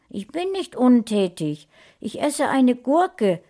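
put-on stroppy teenager. A narrator's reading. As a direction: talk back like a cheeky fourteen-year-old. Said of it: "im Tonfall eines vierzehnjährigen aufmüpfigen Jugendlichen".

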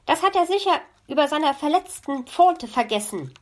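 smooth it over as the raven. A narrator's reading. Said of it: "meinte der Rabe". (Rabenstimme, beschwichtigend)

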